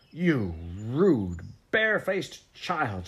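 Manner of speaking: slow, loud, indignant rebuke rising high; angry scolding. A narrator's reading. Missus Biddle stamps and scolds the child, angry and drawn out. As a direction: stretch each insult out in outrage.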